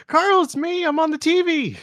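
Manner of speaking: very high-pitched, squealing Donut voice; high, showy TV-debut shout; excited Donut. Acted. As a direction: call out to Carl in a piercing high cartoon voice. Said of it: "Donut will be like".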